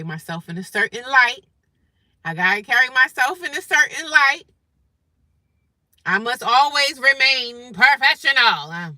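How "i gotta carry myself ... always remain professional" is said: mock professional. Reminding self to stay professional at work, mock-proper. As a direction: declare it with exaggerated, prim projection.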